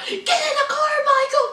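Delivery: screeching at her man to get in; frantic high-pitched begging; mock Sarah. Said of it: "here's Sarah".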